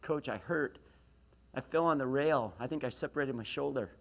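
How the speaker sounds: ordinary talk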